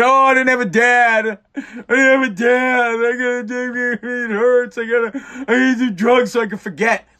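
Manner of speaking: high, whining mock self-pity voice; mocking whiny addict. Host mocking addicts who blame a missing dad, whiny mock voice. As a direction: whine it like a sorry-for-himself junkie.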